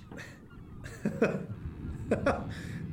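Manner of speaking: unvoiced mocking laugh; breathy scornful laugh. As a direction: scoff at the very idea. Scoffs at Sylvia's accusation.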